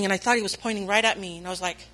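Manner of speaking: ordinary talk, nothing marked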